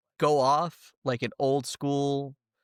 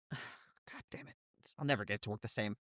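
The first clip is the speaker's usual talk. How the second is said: muttered lament; 3 semitones lower, 13 dB quieter, faster than usual. Acted. Mephit laments broken device.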